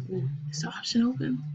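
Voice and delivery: fast, breathless whisper. Real, not acted. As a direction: whisper it quickly to yourself. Checking game option quietly.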